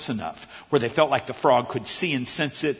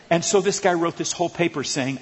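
ordinary delivery

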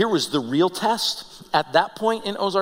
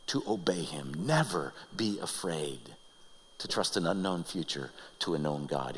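hushed appeal; 11 semitones lower, 11 dB quieter than usual. Real, not acted. Preacher urges listeners not to fear, hushed appeal. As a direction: drop right down to a solemn hush.

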